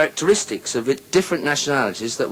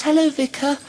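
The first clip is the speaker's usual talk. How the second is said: put-on high-pitched little girl voice; put-on child's greeting, pitched way up. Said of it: "the little girl says".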